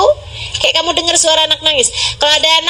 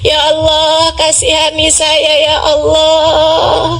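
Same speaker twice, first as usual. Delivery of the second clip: imploring prayer with long drawn-out calls; tearful plea; slower than usual. Acted. Preacher acting out begging forgiveness with tears, pleading. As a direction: plead as if weeping, stretching each cry to God. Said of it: "dengan linangan air mata kamu bilang".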